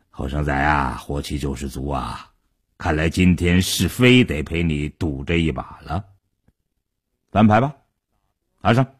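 low, languid elder's voice; deep-pitched, leisurely resignation; unhurried old gangster. A narrator's reading. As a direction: humour the hothead without rushing. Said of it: "傻彪还是一副慢吞吞的模样".

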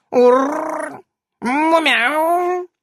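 high purring meow; cat meowing. A narrator's reading. Cat reminding of itself.

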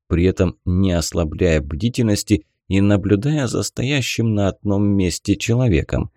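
usual speaking voice

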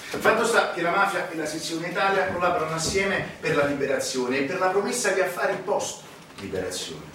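usual speaking voice

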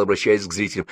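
ordinary delivery, nothing marked

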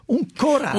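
corallo detto con la doppia esagerata; imitando una doppia sbagliata. Retold or imitated. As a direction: ripete corallo calcando la l. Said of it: "sulle doppie ha un po' di problemi". (corallo con la doppia storpiata)

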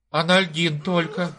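mumbling secretary. Acted. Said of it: "пробормотала секретарша".

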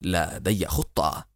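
low, slow, measured firmness; firmer resolve. A narrator's reading. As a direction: say it slowly, mind made up. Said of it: "قبل أن يقول بحزم أكبر".

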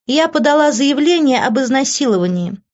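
high-pitched, smug taunting; mocking, self-satisfied woman; taunting schemer Ivy. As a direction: needle them sweetly in a high voice.

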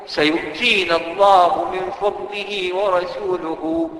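slow, reverent chanting of the complete verse; slow Quran recitation. Shown as a demonstration. Preacher reciting the whole verse to the congregation, slow and reverent. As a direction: recite the full verse slowly, every word drawn out. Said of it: "ليه عايز تخليها".

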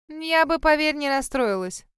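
airy, mildly smug dismissal; scoffing. A narrator's reading. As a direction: brush it off with a light scoff. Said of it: "хмыкнула Шерри".

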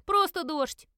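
high, loud brush-off; dismissive scoff. A narrator's reading. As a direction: wave away the fear impatiently. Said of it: "фыркнул Эдвард". (громко и пренебрежительно отмахнуться)

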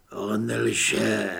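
lightly voiced inner monster accusing Earl; deep raspy voice. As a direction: let the beast inside speak. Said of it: "hluboký a chraplavý".

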